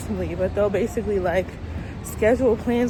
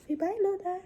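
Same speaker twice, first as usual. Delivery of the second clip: high-pitched, sing-out goodbye for the dog; pet talk; 11 semitones higher, 6 dB quieter than usual. Real, not acted. Vlogger prompting Lola to say bye, high pet talk. Talking to her pet at sign-off.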